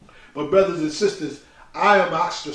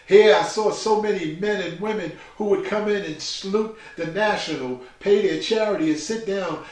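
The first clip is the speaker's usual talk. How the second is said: ordinary talk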